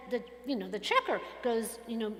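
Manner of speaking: ordinary talk, nothing marked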